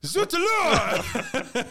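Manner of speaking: high, slow, hammed French accent; French chef. Mock French exclamation.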